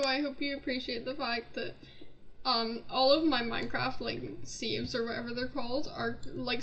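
ordinary talk

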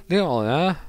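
slurred northern mumble, high and loud; exaggerated Preston garble; Preston accent. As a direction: imitate a Preston man swallowing every consonant. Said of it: "he literally said".

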